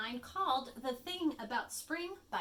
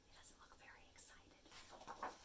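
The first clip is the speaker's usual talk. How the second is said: whisper aside; 20 dB quieter, slower than usual. Real, not acted. Librarian whispering to children about grumpy Rabbit, hushed. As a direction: lean in and whisper it.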